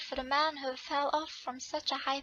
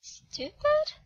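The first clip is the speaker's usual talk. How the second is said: much higher, offended rise on "Stupid?"; outraged stupid; 12 semitones higher, 3 dB louder than usual. Acted. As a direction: squeal it back in disbelief. Girl outraged by insult.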